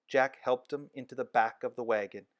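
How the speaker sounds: ordinary delivery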